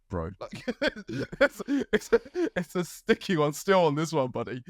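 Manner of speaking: amused scolding of a hapless stranger; chuckling "what were you thinking"; laughing disbelief. Retold or imitated. Telling a drug buyer off, amused.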